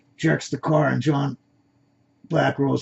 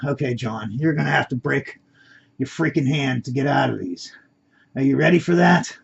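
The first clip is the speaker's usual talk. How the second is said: ordinary delivery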